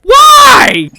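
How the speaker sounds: high, loud outraged cry of why; a real shocked shout; shocked yell. Real, not acted. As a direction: scream it at the screen in disbelief. Shocked reaction to show twist.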